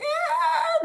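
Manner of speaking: strained wounded-cat wail; wounded cat cry. Shown as a demonstration. Reader mocking her own attempt, wounded-cat wail. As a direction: moan like a hurt cat. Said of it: "a wounded cat".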